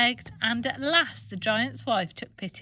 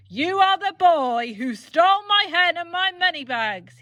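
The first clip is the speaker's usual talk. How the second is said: loud, higher furious giant; bellowing; 7 semitones higher, 5 dB louder than usual. Acted. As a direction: bellow the accusation. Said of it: "he bellowed".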